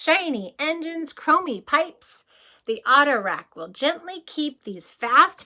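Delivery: lively read-aloud, rising brighter on the race cars; storybook reading. Acted. Reading about race cars to children, bright then sleepy. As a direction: show off the shiny cars, then settle down. Reads picture book aloud.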